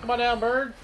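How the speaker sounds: loud high taunt; calling out. Real, not acted. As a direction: taunt the bird from below. Calling to bird.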